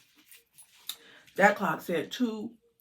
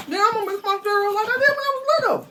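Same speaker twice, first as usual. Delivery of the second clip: much higher and fast; playful; 4 dB louder, faster than usual. Real, not acted. Showing viewers her cereal, childlike.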